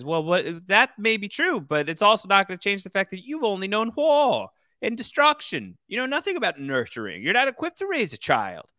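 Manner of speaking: high-pitched, scornful mock retort; clapback. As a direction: act out a withering put-down. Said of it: "Mother once again with the, with the, the clapbacks".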